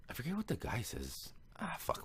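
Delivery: soft, whispery self-talk; whispered aside. Real, not acted. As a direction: whisper it to yourself as memory fails. Muttered aside while losing the thread.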